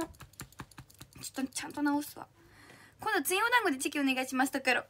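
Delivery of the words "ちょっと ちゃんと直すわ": whispered aside. Real, not acted. Muttering to herself mid-sentence.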